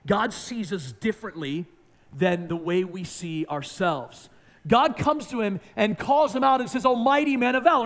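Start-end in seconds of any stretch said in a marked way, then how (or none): 4.7-7.9 loud, high preaching call; the sermon's ringing call; rising preaching. Acted. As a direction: build it up and call it out. Preacher builds to exclamation.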